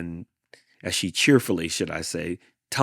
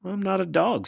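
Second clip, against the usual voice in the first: indignant; 12 semitones higher than usual. Acted. Coyote answering Hank.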